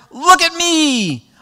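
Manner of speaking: put-on high drawn-out childish look at me; toddler showing off. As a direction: stretch it out like a proud toddler. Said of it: "They were doing it with basically".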